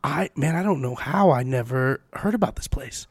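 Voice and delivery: quiet sincere admission; showing guests the polite thing to say; humble line. Shown as a demonstration. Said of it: "use this line".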